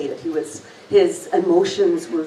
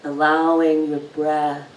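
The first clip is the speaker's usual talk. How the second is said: guided meditation; slower than usual. Acted. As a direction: ease them into calm, slowly.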